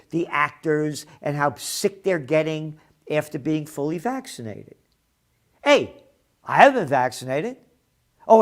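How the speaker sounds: ordinary delivery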